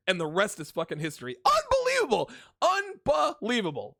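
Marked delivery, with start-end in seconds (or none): 1.4-2.3 high, loud cry of disbelief; incredulous outburst. Real, not acted. Storyteller capping his wild story, high incredulous shout. 2.6-4.0 "Unbelievable" slow and high, broken apart mid-word; drawn-out disbelief. Real, not acted. Storyteller hammering home his amazement, "Unbelievable" high and drawn out.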